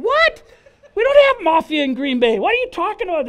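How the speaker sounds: high, loud, stunned What?; a startled cry of disbelief; shocked disbelief. Retold or imitated. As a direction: blurt it out in disbelief. Shocked at mafia news.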